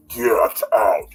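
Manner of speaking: low, loud spooky command; menacing ghostly warning. Acted. Spirit warning someone who walked into a place, low and menacing. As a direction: order them out like an unseen presence. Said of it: "you walk into a place and you hear".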